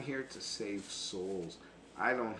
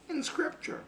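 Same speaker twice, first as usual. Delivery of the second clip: falsetto squeak; 3 dB louder than usual. Real, not acted. Falsetto squeak while ranting.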